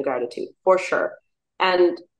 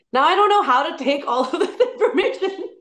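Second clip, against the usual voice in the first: laughing; 11 semitones higher than usual. Real, not acted. Laughs, overwhelmed by news.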